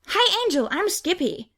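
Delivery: put-on high, eager boyish greeting; excited shout. A narrator's reading. Lost boy shouting his name in Angel's face, excited. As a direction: bounce the name out like a preschooler. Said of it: "one of them shouts in my face".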